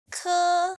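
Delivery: "Ke" high, loud and level; first tone. Shown as a demonstration. Teacher introducing first tone, "Ke" high and flat. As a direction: hold "Ke" flat on a high pitch. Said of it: "a flat high tone".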